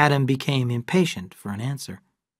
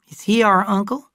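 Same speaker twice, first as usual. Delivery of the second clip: a lightly voiced child, higher; curious boy, pitched up; child Cal; 9 semitones higher, 5 dB louder than usual. Cal asking his father about the uncle, lightly voiced child.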